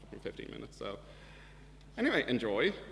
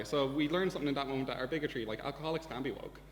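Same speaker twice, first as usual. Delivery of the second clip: ordinary delivery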